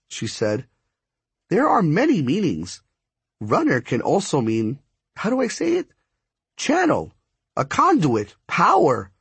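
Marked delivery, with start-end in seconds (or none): 1.5-9.1 high-pitched voice searching for the word; spark striker explaining. A narrator's reading.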